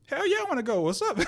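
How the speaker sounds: high-pitched imitation of taking up a challenge; mock aggressive comeback. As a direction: accept the fight in a comically high voice. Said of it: "he think he being aggressive".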